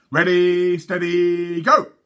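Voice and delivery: high, loud race call; shouted start. A narrator's reading. Twins starting the snail race, shouting. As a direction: yell the start like excited boys. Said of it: "they shouted".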